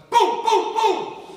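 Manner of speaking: loud, high booms like gunfire; shouted gunshot booms. Retold or imitated. Telling the audience about the shots, loud booming. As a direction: blast out the gunshots.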